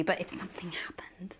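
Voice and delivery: whispered confession; whispering so nobody else hears. Acted. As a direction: breathe it like a secret. Confessing secret love to Alex.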